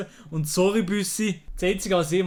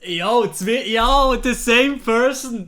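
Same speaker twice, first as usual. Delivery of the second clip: loud and high hype; excited calling out; 6 semitones higher, 6 dB louder, much slower than usual. Real, not acted. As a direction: hype up the chat. Calling out to chat.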